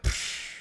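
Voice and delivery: voiceless puff of air; dismissive scoff. Retold or imitated. Answering the security guard's advice, retold dismissive scoff. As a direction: blow it off with a psh.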